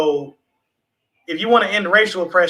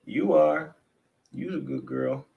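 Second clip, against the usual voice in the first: soft, low cooing to a cat; tender pet talk; 3 semitones lower, 7 dB quieter, slower than usual. Real, not acted. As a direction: croon slowly and softly to your pet. Baby-talking to pet cat Willow.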